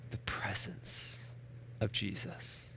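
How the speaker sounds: breathy whispered words. Acted. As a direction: whisper it like a secret. Hushed climax of soft passage.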